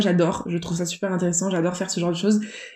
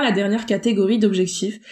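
ordinary talk

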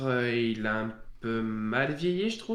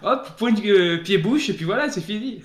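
excited; 9 semitones higher, 11 dB louder than usual. Real, not acted. Laughing protest about Smash.

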